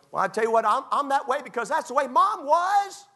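very high, loud cartoonish whine; hammed-up blame-shifting in a piercing high voice; mock someone passing the buck, high and loud; mock excuse-maker. Mimics people blaming their parents.

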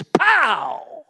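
loud, high mock gunshot "Pow!". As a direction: bark out a rifle shot. Gunshot in a retold killing.

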